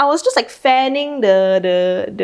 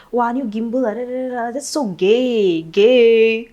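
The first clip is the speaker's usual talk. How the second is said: slow, drawn-out mock teasing; mocking coworker; 2 semitones higher than usual. Coworker teasing him over the new gimbal, mock sneer. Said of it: "He's like".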